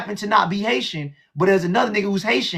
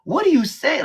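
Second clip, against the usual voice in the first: confused surprise; 6 semitones higher, slower than usual. Retold or imitated. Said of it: "I was like".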